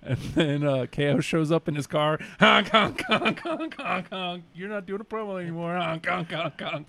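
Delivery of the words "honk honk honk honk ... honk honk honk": high honking car horn noises; silly clown honking. Retold or imitated. Imitating Owens honking.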